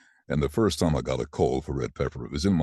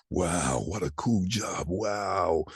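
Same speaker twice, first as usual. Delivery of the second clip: put-on boyish awe; 4 semitones higher, much slower than usual. Young boy watching fire brigades go past, awed. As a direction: gasp it like a kid watching fire engines. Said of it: "I'm thinking".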